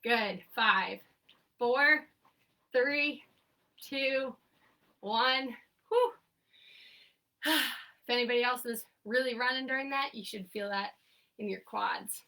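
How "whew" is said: relieved whew. Real, not acted. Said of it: "try and catch my breath".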